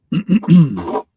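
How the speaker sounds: loud raspy throat clear. Real, not acted. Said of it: "excuse me".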